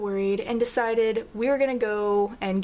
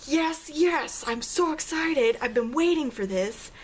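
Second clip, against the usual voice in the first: thrilled, higher cheering; excited; 5 semitones higher, slower than usual. Retold or imitated. Reacting to the fiance saying the cat is in labor, thrilled and higher. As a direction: gush with glee over the news. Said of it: "my reaction to that was".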